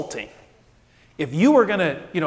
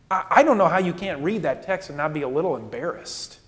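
usual speaking voice

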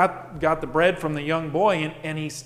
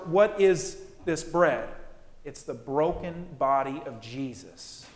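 ordinary talk, nothing marked

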